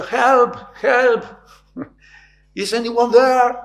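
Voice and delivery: high, loud cry for help; shouting for help. Retold or imitated. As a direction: yell out from the branch. (high, loud cry for help)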